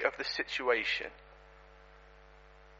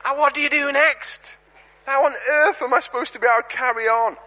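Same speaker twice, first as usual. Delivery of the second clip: flustered preacher; 7 semitones higher, 4 dB louder than usual. Retold or imitated. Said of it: "just going".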